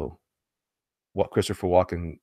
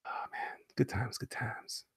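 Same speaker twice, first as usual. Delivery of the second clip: whisper; 14 dB quieter, slower than usual. Real, not acted. Fond aside after laughing.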